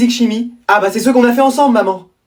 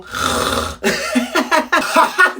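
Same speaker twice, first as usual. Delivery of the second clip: high burst of laughter; 8 semitones higher, 4 dB quieter than usual. Acted. Class laughs at the imitation.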